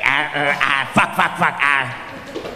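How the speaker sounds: jerky, hammed-up involuntary outbursts; mock verbal tics. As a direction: blurt it out in twitchy bursts. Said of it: "marionette with Tourette's".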